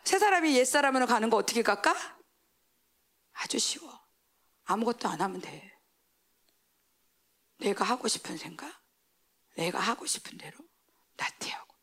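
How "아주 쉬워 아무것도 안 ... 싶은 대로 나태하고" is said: soft, low, breathy aside; whisper. Real, not acted. Preacher describing sliding back into sin, whispered low. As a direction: let it sink to a whisper. Falling back into the old self.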